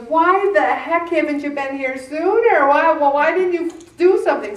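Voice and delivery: mock scold from the doctor; imitating the doctor. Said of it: "he goes".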